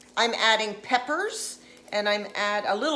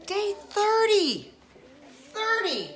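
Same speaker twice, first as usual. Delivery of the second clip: sing-song call; 9 semitones higher than usual. Acted. Host calling out day, sing-song.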